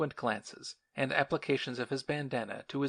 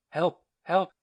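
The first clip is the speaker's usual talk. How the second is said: high, loud desperate shout; a drowning man screaming for help; cry for help; 5 semitones higher, 7 dB louder than usual. A narrator's reading. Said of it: "a shout for help".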